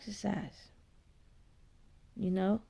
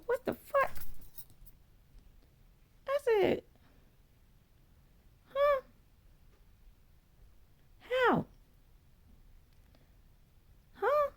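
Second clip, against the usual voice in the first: high, stunned squeak of disbelief; like hearing something impossible; shocked disbelief; slower than usual. Retold or imitated. Retelling shock at God's words.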